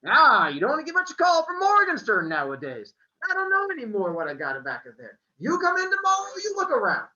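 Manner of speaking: put-on high, loud accented shopkeeper; Italian accent, bookseller answering; thick accent. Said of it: "His accent was thick".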